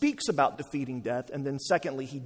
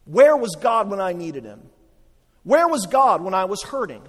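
louder, higher, pained cries of why; anguished complaint to God; 4 semitones higher, 8 dB louder than usual. Retold or imitated. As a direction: voice a grieving person's accusations at God. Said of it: "That might be a question you're asking".

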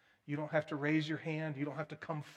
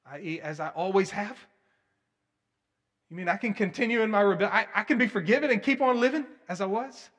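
incredulous; 5 semitones higher, 10 dB louder than usual. Retold or imitated. Mocking an unrepentant listener.

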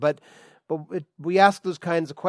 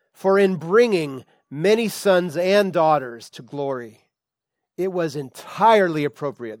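scripture reading; 2 semitones higher, 7 dB louder than usual. Acted. Preacher reading the verse aloud to the church, solemn and emphatic. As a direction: lift the verse out for the congregation.

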